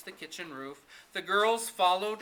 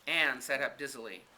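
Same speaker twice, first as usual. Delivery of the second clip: plain reading voice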